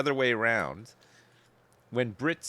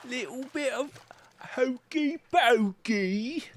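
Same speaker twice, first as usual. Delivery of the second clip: much higher, slow and sing-song; silly high voice; slower than usual. Pretend. Repeating the line to chat, silly high voice. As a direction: drag out hokey pokey up high.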